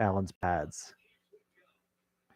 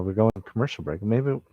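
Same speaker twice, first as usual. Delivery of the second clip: normal talking voice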